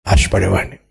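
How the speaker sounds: ordinary delivery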